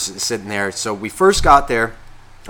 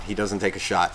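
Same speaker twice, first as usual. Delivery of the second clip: usual speaking voice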